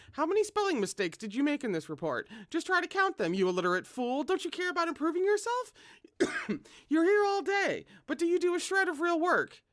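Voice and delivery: loud, high scolding rant; tongue lashing. A narrator's reading. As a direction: berate him like an angry boss. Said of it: "unleashed a tongue lashing".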